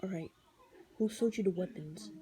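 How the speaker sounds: a lightly deepened calm hero voice; calm Daredevil voice. Shown as a demonstration. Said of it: "he kinda does his voice like".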